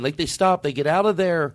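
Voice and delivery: ordinary talk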